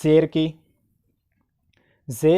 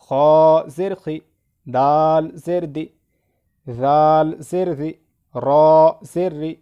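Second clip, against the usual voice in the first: measured letter names, short final syllables; spelling drill recitation; 4 dB louder, slower than usual. Shown as a demonstration. Teacher leading learners through zer, drill recitation. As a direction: recite each letter with zer in a steady rhythm.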